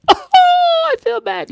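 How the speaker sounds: high, wailing fake sob; mock crying. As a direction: ham up hurt feelings in a mock cry. Teased about forgetting a name.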